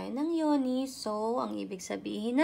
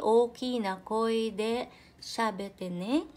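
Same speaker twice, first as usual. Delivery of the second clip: slow, clear model sentence; slow and voiced; 4 dB louder, slower than usual. Shown as a demonstration. Teacher repeating the example slowly to her students. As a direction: say it slowly, in full voice.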